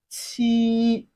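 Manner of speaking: "qi" held high and level; flat tone. Shown as a demonstration. Teacher models Chinese seven for learners, flat-tone "qi". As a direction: sustain "qi" on one unbending pitch. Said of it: "It has a flat tone".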